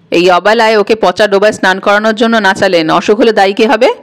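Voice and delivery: usual speaking voice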